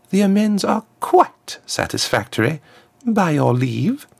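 high, smooth voice of a formal diplomat; a smooth ambassador, pitched well up; smooth contralto ambassador. A narrator's reading. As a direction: address royalty smoothly in a high-set voice. Ambassador takes her leave.

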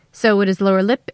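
usual speaking voice

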